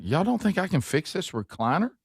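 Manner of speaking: high mock indignation; mock offended.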